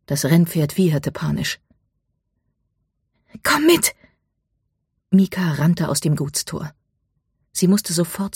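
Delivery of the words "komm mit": high, urgent yelled command; shouted order over a storm. A narrator's reading. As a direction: call out to be followed, high and urgent.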